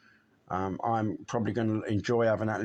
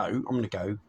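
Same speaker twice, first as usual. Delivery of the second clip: usual speaking voice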